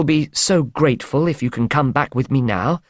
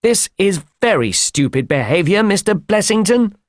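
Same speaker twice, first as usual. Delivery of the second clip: mildly angry scolding, rising on the name; angry cry; 8 semitones higher than usual. A narrator's reading. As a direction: scold him with restrained anger. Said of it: "cried the doctor angrily".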